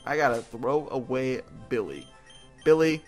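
normal talking voice

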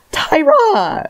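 high-pitched, drawn-out cry of protest; long, high exasperated wail; exasperated call. Real, not acted. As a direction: call out the name in mock outrage, very high. Exclaims at the author's absurdity.

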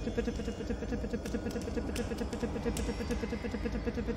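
very soft, rapid whispered begging; whispered pleading. Real, not acted. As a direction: beg under your breath. Hiding, begging under breath.

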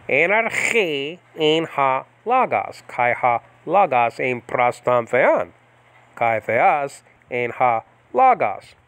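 very slow, measured Koine reading; slow classroom recital of the Greek; biblical Greek pronunciation. Shown as a demonstration. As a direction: halagas with open a sounds, drawn out. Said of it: "biblical pronunciation first".

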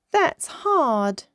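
groaned complaint. A narrator's reading. Little dolphin struggling to copy her cousin, groaned. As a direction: groan it a little, pitch lifted. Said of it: "groaned Minnie Maui".

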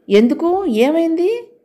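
high, rising startled question; asked in sudden alarm, pitch shooting up; surprised. Acted. Father just home, startled by the news. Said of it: "ఆయన ఆశ్చర్యంగా చూశారు".

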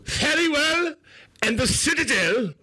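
high, slow hammed-up English herald accent; bad English accent. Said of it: "his English accent need work".

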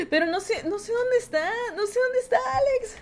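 high, whimpering fret; rising plaintive whine about Alex; whiny worry. Real, not acted. Can't find Alex, whimpering. (lloriqueo preocupado, agudo)

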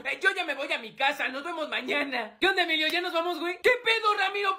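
usual speaking voice

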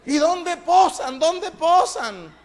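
high, loud and slow, drawn-out eager ask; camp mock question. Sodom's men asking where angels lodge, high slow camp. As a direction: lean in, eager and coy.

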